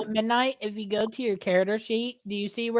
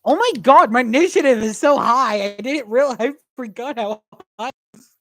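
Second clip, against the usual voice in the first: higher, louder burst of amazement; excited surprise; 3 semitones higher, 8 dB louder than usual. Real, not acted. Player amazed at their own high initiative roll, excited surprise. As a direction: blurt it out, thrilled and startled.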